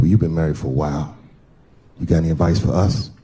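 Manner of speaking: low-pitched, earnest asking; friend's voice. Acted. Groom asks married friend for advice, low-pitched. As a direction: play the friend well below your own pitch.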